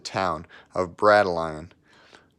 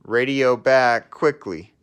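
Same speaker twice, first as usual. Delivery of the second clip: urgent order; 4 semitones higher, 6 dB louder, slower than usual. Acted. Pippa urging Budgie.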